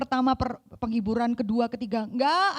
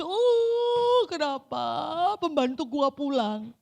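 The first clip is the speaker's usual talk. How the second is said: high, hammed-up whimper on the Oh; mock wailing; 3 semitones higher, slower than usual. Preacher mocks someone crying too much over a maid, mock wail. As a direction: parody an over-the-top crybaby. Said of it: "ekstrim yang nangis".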